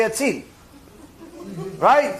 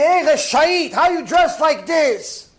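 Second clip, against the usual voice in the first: acted scornful taunt, pitched up; mocking jeer; 7 semitones higher, slower than usual. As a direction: jeer as if heckling in the street. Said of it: "to make fun of them".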